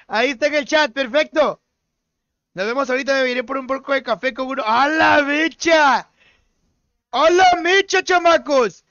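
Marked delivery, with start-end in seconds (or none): 4.7-8.8 rallying shout. Real, not acted. Rallying teammates to start match.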